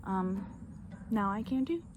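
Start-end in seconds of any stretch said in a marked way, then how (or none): none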